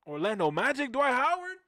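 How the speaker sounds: loud question climbing high; amazed. Retold or imitated. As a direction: act out wide-open disbelief, building to the name. Retold amazed discovery.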